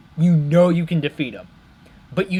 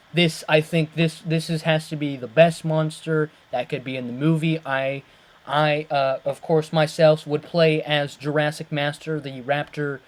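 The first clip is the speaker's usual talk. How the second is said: ordinary talk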